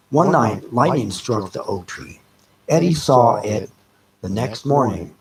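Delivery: ordinary delivery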